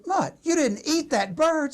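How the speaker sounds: high-pitched, drawn-out dismay; acted disbelief. Pop confronting Mutt who ate his gift, aghast. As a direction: act aghast, slow and high. Pop learns bird was eaten.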